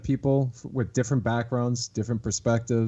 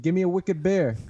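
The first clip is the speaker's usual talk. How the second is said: higher, hammed-up Boston bar order; Boston accent; 6 semitones higher than usual. Shown as a demonstration. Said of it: "say "wicked" in our sentences".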